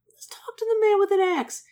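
hushed unvoiced whisper; whispered asmr role play. Real, not acted. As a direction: whisper it close to the mic. Whispered choice aside.

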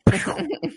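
high mouth-made blaster zap; imitated blaster shot. Podcaster retelling the droid being blasted, imitated laser shot. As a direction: make a blaster noise with your mouth.

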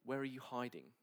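usual speaking voice

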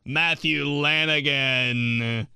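big, drawn-out announcer voice. Acted. Reading a dream name for an announcer, grand announcer voice. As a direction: announce the name slowly, stretching it out. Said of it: "a dream name for an announcer".

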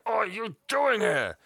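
high, slow, drawn-out mock outrage; hammed-up, high-pitched indignant question; outraged disbelief. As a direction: act out a flabbergasted villain. Can't believe what's going on.

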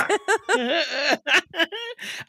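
high burst of laughter; hearty laugh. Real, not acted. Host laughing at co-host's noises, high laugh.

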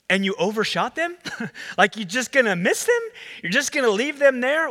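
high, loud, baffled questions; can't believe they'd just leave them; incredulous disbelief. Retold or imitated. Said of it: "I'm frustrated by it".

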